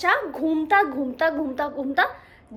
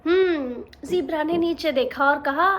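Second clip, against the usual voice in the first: ordinary delivery, nothing marked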